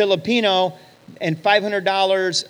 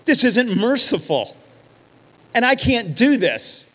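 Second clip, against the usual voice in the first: slow, higher, earnest; inner conviction; 5 semitones higher, slower than usual. Retold or imitated. Friend's conscience rising against injustice, slow and earnest. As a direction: let the conscience speak slowly. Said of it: "Something rose up inside of him saying".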